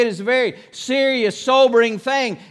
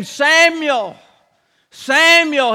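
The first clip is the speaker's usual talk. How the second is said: calling voice; 3 semitones higher, much slower than usual. Acted. God calls boy at night.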